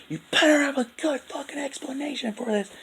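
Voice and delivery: very high put-on voice, mock accusing; imitating angry friend. Imitating Dopey accusing his friend, very high mock voice. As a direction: hiss the threat in a caricature voice. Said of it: "and he says".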